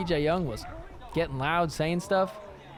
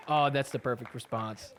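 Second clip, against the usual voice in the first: usual speaking voice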